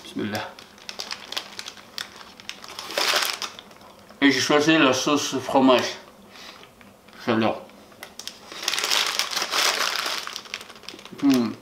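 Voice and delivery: speaking with food in mouth; words between bites of food; talking while chewing. Real, not acted. Seen 0: Bites the wrapped food at -4 to -3, mouth still working while talking.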